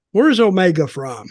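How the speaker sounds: usual speaking voice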